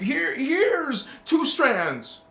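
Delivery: high, slow mock handing-over voice; mock giver. To viewers, mocking a magical DNA gift, high and slow. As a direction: dole out strands like a mocking fairy.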